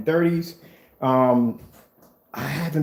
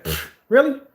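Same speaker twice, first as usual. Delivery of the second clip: scoffing, much higher; mock disbelief; 11 semitones higher, slower than usual. Imagining himself facing paperwork, scoffing and high. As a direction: scoff at three pages. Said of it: "I'd be like".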